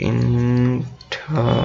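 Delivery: Internet very slow, "innn - tuhh - net"; syllable by syllable. Shown as a demonstration. Teacher breaking the word down for students, very slow stretched internet. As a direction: draw out each syllable of internet. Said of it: "তাহলে এবার".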